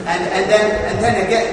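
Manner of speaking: ordinary talk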